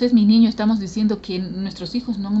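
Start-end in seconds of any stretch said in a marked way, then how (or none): 0.0-0.5 loud fond cry of mi niño; doting parent. Retold or imitated. A parent loudly calls an adult son "mi niño". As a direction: call him a baby with fondness. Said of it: "cuando nos referimos".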